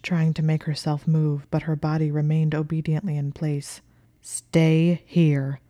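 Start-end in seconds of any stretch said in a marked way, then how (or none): 4.2-5.6 loud, heavy order; forceful command. A narrator's reading. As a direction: command her to stay put. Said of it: "using an even more forceful tone".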